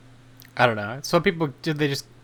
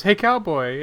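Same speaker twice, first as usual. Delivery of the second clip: higher, louder mock hail; calling out; 7 semitones higher, 6 dB louder than usual. Man outside hailing a cowboy on the street, calling out. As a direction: call across the street to a passerby.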